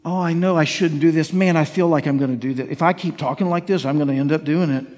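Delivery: low, mock-defeated self-talk; weak-willed reluctance. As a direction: grumble it like someone already giving in. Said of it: "how different is that when you go like".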